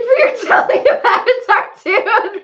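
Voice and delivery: high, loud, breaking up with laughter; amused teasing. Real, not acted. Listener amused by friend's movie retelling, laughing hard. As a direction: laugh through the compliment.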